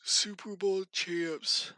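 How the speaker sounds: slow, drawn-out sarcastic jab; mock the champs, dragging each word. Real, not acted. Mocking the losing Rams.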